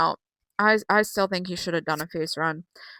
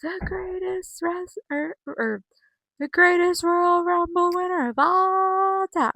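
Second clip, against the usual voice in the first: high, slow drawn-out pronouncement; a high slow mock grand claim; mocking Liv; 8 semitones higher, slower than usual. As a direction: stretch it out high and grand like a self-crowned champ. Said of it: "my ears when Liv goes".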